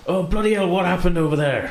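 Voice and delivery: high, startled farmer's cry; a shocked farmer yelling at strangers; farmer's alarmed outburst. Acted. Farmer to the party, high and startled. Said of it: "He says, he says".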